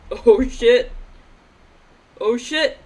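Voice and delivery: loud exclamation. Real, not acted. Reacts to a funny character design.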